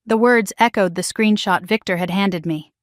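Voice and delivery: ordinary delivery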